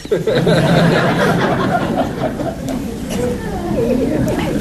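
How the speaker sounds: real amused laugh, high. Real, not acted. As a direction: burst out laughing at the grumpy disciple. Laughs at sourpuss line.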